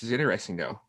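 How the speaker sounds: ordinary talk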